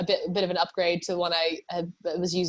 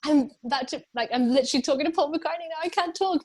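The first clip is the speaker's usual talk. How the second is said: higher-pitched mock fluster; retold flustered protest; 7 semitones higher than usual. To a friend while meeting [name], mock flustered. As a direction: act out a high, urgent brush-off. Retells being interrupted meeting a star.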